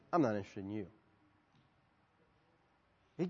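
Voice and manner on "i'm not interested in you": low, quiet dismissal; acted-out understated brush-off; cool rejection. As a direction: shut them down softly. Deadpan retort from the refuser.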